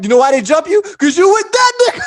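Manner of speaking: high, loud and breaking into laughter; loud teasing retort. Retold or imitated. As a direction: build to a laughing shout. Mock retort to Blaine, laughing.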